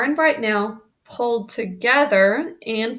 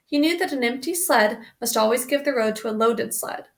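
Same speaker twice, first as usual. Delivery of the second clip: usual speaking voice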